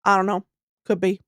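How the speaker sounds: a hint of a stoic kid from the swamp, deadpan; mild imitation of an emotionless eight-year-old boy; Southern swamp boy. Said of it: "impression of this boy".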